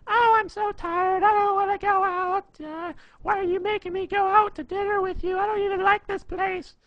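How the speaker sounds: very high, loud whining falsetto; bad mock female voice, shrill and dragged out; shrilly high-pitched girl impression. Shown as a demonstration. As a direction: do a badly faked girlfriend, loud and squeaky. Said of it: "really high-pitched, shrilly voice".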